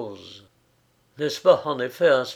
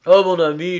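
louder, higher, urgent outburst; deeply moved, voice raised; agitated; 4 semitones higher, 9 dB louder, faster than usual. A narrator's reading. Pale friend rushes up to Frédéric, agitated. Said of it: "très ému".

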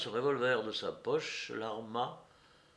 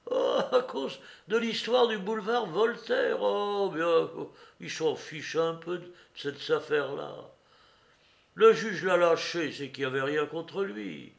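higher voice, amused scorn; dismissive woman; 5 semitones higher, 6 dB louder than usual. A narrator's reading. Woman defends Zigzag over boulevard affair, dismissive. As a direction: brush the accusation aside mockingly.

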